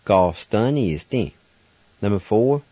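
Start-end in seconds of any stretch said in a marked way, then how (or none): 0.1-1.3 ga, stun, ni, s, tin strung together in one run; all together. Shown as a demonstration. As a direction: join the syllables into one flowing word. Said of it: "And all together".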